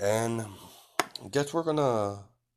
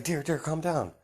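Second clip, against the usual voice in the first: higher, coaxing "dear, dear"; calming dear; 9 semitones higher than usual. Retold or imitated. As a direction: soothe a panicked friend in a raised voice. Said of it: "as Kagura's like".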